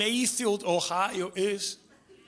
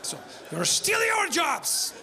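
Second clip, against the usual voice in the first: much higher, laughing mock shout; mock accusation; 9 semitones higher than usual. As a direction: turn the accusation around. Accusing Chad mockingly.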